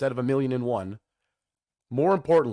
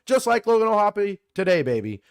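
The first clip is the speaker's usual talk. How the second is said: higher, louder hype about the homer; excited; 8 semitones higher, 5 dB louder than usual. Real, not acted. Host cheering a home run to listeners, excited. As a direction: punch it with a fan's thrill.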